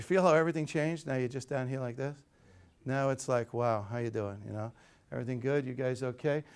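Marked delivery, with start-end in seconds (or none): 1.1-6.4 deflated, sagging greeting; slumped, low energy. Shown as a demonstration. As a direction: lower and softer, shoulders dropped. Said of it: "just let your shoulders go a quarter inch".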